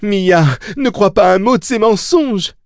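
high-pitched cry of warning; desperate yell. A narrator's reading. Susanna warns Mia against lies, yelling. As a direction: cry out high to make her listen. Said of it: "hurla Susanna".

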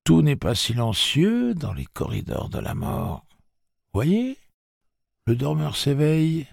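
distant murmur. Acted. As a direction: murmur as if from far away. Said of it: "Eddie murmura d'une voix lointaine".

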